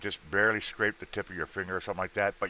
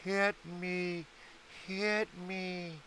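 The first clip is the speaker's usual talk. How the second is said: high-pitched voice of a thumbs-up button; button begging; 13 semitones higher, 7 dB quieter, much slower than usual. Retold or imitated. Host voicing the like button to viewers, coaxing squeaky voice. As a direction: squeak it like the button calling out to you. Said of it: "It says".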